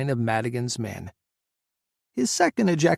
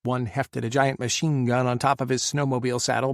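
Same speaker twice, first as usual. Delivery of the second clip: usual speaking voice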